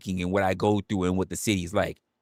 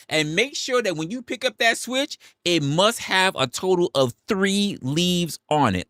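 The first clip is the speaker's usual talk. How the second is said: hammed-up mom orders; 10 semitones higher than usual. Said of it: "continuing mom's voice".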